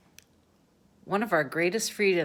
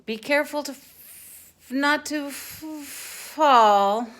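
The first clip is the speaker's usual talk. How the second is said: anxious warning; 10 semitones higher, 8 dB louder, slower than usual. A narrator's reading. Warning of a fall.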